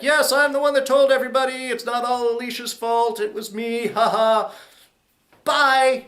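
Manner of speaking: high, loud, sing-song mock confession; mocking a character's farewell. Recapping a character's exit line.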